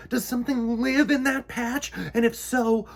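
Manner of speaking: nervous bee asking the queen what lurks; worried little bee. A narrator's reading. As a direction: fret over what might be waiting. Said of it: "Mister Bumble asked".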